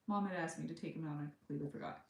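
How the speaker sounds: ordinary talk, nothing marked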